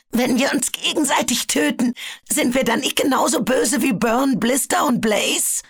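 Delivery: a cornered dragon crying out for mercy; desperate plea. A narrator's reading. Webbs begs his comrades not to kill him, shouting.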